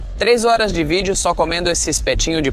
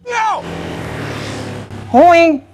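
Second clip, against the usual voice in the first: much higher squeaky protest; squeaky outburst; 13 semitones higher, slower than usual. Real, not acted. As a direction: squeal each word up high. Outburst over bad food.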